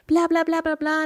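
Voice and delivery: high-pitched, monotone blah-blah; mock rambling. Woman imitating herself airing feelings to her husband, high mock rambling. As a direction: rattle off blahs on one high note.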